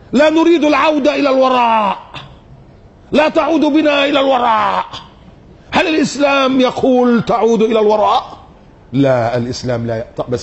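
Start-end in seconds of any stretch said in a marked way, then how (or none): 0.1-8.4 raised preaching voice. Acted. Preacher challenging the critics with a raised voice.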